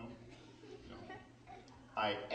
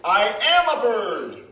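proud shout; 11 dB louder, slower than usual. Acted. Loud proud bird claim.

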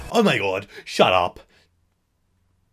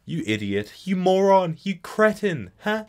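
higher and louder, a hammed-up tirade; mock insult; 8 semitones higher, 4 dB louder than usual. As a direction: pile on the insults in mock outrage.

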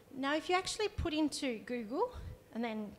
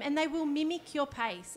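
ordinary talk

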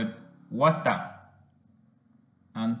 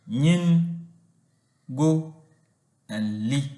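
"nyin", "go", "li" each said slowly as its own beat; syllable by syllable; 3 dB louder, slower than usual. Shown as a demonstration. Teacher sounds out syllables for pupils, slow and separate. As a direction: break the word into its syllables for the class. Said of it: "has three syllables".

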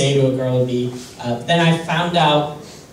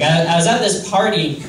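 ordinary talk

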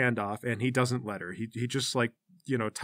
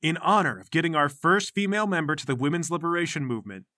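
normal talking voice

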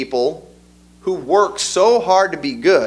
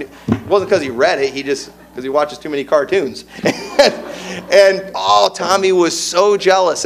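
ordinary talk, nothing marked

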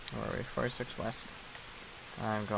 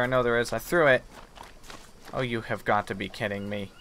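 ordinary delivery

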